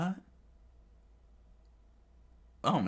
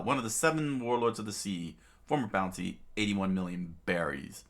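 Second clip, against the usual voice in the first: ordinary delivery, nothing marked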